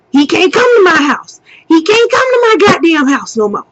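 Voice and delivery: high, loud, fast ranting mother; fired-up mom. Retold or imitated. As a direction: rant like a furious mom laying down the law. Mom repeating the ban, fired up.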